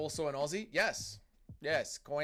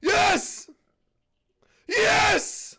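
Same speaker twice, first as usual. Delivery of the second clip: loud, high cheering as his fighter scores a knockdown; excited cheering; 13 dB louder, much slower than usual. Real, not acted. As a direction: yell it out at the screen, thrilled.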